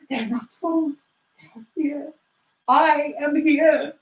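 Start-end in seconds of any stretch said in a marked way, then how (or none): 0.1-2.1 hushed wonder. Acted. Relief after the outburst.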